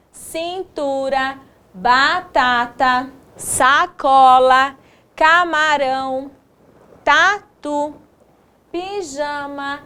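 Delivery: slow, measured word-by-word reading; reading word list. Shown as a demonstration. Teacher reads the completed words to pupils, slow measured reading. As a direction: read each word slowly and evenly, pausing between them. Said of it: "vamos fazer a leitura".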